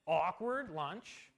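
slowed, drawn-out "awkward lunch"; deadpan punchline. Real, not acted. Pastor ending his lunch story to the congregation, deadpan and slow. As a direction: let the irony land slowly.

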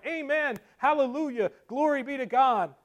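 imitating fervent, high-pitched congregation; crying out praise. Brethren responding to Spurgeon's preaching, crying out. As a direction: call out each acclamation with a falling tune. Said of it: "the more they cried".

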